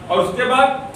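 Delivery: ordinary talk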